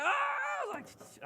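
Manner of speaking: long, very high drawn-out shout; guy thrilled she asked, long high yell; excited yelp. Retold or imitated. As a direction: stretch the yelp out slowly. She hints he should ask.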